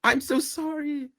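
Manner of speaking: slow, very high mock plea; hammed-up squeaky grovelling; begging for life. Defeated villain on his knees pleading for his life, mock begging. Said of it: "he starts begging for his life".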